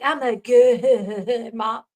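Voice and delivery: drawn-out mock laugh through the words; mocking imitation. Host mimics a streamer claiming good motherhood, mocking laugh. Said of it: "She's like".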